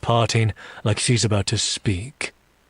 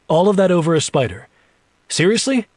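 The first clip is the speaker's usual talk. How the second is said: quick, high disbelief; incredulous; 8 semitones higher, 4 dB louder, faster than usual. Acted. Jack reacting to the spider excuse, incredulous. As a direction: rush it, jumping higher on the last word.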